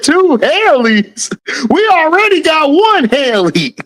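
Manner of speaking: high, incredulous mock objection; mock disbelief. Voicing a chatter who objects to two Haleys, mock disbelief.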